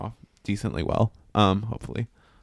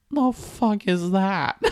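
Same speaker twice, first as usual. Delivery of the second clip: 1 incredulous; 10 semitones higher, slower than usual. Retold or imitated. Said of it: "I'm like".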